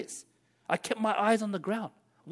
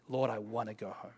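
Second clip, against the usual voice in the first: hushed prayer; 10 semitones lower, 12 dB quieter than usual. Acted. Congregation praying like a child.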